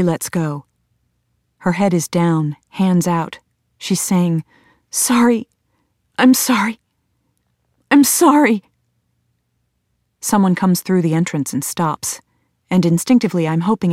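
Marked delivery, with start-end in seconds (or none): 4.9-8.6 high, pleading apology in separate bursts; distraught apology. A narrator's reading. Erin with head down after lunging at someone, distraught apologising. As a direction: plead it, pitch climbing with each repeat.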